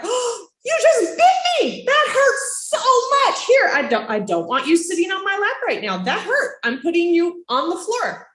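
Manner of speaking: high pained parent setting a limit; honest hurt reaction. Shown as a demonstration. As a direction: show the toddler how much that hurt. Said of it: "You can react like".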